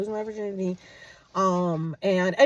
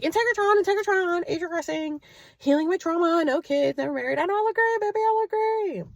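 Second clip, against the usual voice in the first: high, showy self-praise; sassy bragging; 9 semitones higher than usual. Retold or imitated. Her boast after the date guesses her younger, high sassy. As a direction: flaunt it, voice pitched way up.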